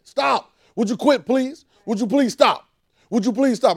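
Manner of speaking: high, loud exasperated plea to stop; loud, pleading Jesus; Jesus, fed up. Retold or imitated. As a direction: voice Jesus begging them to quit. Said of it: "I see Jesus talking to me that way".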